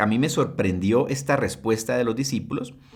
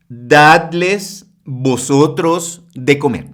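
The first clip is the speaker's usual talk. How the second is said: solemn command; 3 semitones higher, 8 dB louder, much slower than usual. Acted. Preacher voices Jesus' command.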